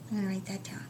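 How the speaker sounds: soft unvoiced aside; teacher whispering to herself as she writes. Shown as a demonstration. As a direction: whisper it to yourself while writing. Teacher aside while writing.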